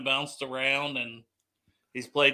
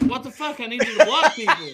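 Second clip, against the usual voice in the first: higher, louder mock outraged coach; 8 semitones higher, 6 dB louder than usual. Mocking the coaches reacting to the British lineman, outraged. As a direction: play a coach baffled by the Brit. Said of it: "and they're like".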